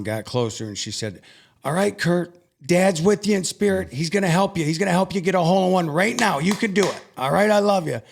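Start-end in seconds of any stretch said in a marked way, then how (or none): 1.6-8.0 high, urgent motherly encouragement; high-voiced rallying pep talk; acted pep talk. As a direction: imitate mom cheering on her son, pitched up.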